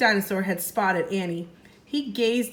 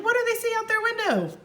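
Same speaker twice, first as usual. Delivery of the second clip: 1 mock surprise; 13 semitones higher, faster than usual. A narrator's reading. Teacher teasing the reveal to kids.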